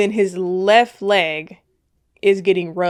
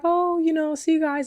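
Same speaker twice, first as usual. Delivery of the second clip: wife's goodbyes; 8 semitones higher than usual. Retold or imitated. Voices wife saying party goodbyes.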